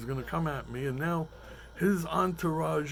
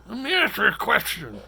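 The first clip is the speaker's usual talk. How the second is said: put-on southern accent; 5 semitones higher, 6 dB louder than usual. Bar patron in Georgia turning to the comic, in a light Southern accent. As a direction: lean into a Georgia barfly's accent. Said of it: "with a southern accent".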